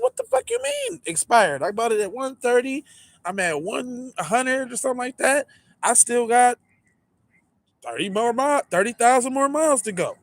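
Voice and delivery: high, indignant retold outburst; outraged protest. Caller yells at the Tesla rep over the expired warranty, high indignant. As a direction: squeak it out in disbelief. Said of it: "And I'm like".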